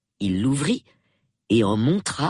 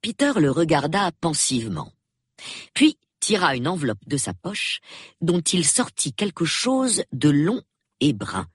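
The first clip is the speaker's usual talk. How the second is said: usual speaking voice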